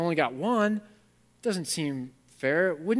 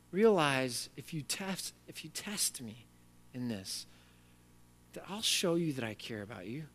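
gentle God; 2 semitones lower, 8 dB quieter than usual. Acted. God voiced, gentle invitation.

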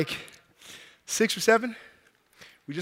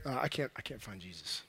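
low, soft, hesitant admission; sheepish confession; 12 semitones lower, 18 dB quieter than usual. Acted. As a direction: mumble it like a guilty husband. Joseph timidly confesses to Mary.

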